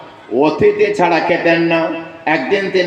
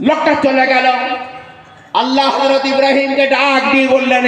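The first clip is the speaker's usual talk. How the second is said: raised, higher ringing exhortation; preaching at full force; 8 semitones higher than usual. Acted. Preacher urging listeners to give to beggars, raised exhortation. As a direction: lift the voice and urge the crowd. Sermon climax narration.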